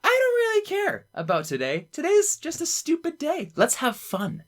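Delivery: high, airy paraphrase voice; mock carefree voice. Explaining the quote's meaning in a high mock voice. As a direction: toss it off high and light. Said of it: "that just means".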